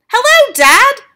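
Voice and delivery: put-on boy turned mouse popping out of the handbag; cheeky mouse-boy. A narrator's reading. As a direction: chirp it cheekily. Bruno greets his father.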